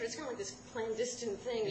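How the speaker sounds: usual speaking voice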